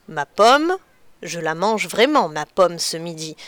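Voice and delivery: ordinary talk, nothing marked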